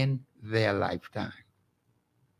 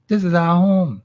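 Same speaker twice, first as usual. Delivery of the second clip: emphatic; 2 semitones higher, 8 dB louder than usual. Real, not acted. Emphatic after whisper.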